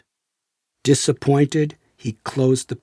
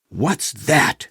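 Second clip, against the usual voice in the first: ordinary delivery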